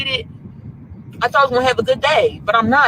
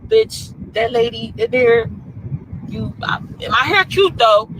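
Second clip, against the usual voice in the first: ordinary talk, nothing marked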